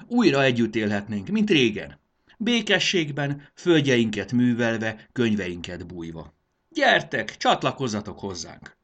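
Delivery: loud, stretched-out pleading speech; rallying appeal. A narrator's reading. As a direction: paint the promise for a whole army. Rallying appeal to the enemy troops.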